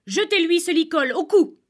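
high, loud command; urgent order. Acted. Magician orders the shepherd to throw the halter, urgent.

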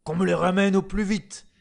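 loud, high, furious order; angry shouted command. Acted. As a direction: bellow the threat. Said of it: "s'écria l'empereur".

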